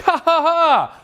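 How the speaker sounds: high, staged ha-ha laugh; exaggerated high-pitched laughing; mock laughter. His reaction to being pushed.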